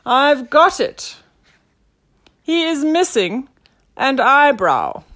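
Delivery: loud, high, drawn-out announcement; grand detective reveal; triumphant detective. A narrator's reading. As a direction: proclaim the great discovery. Detective announces his discovery.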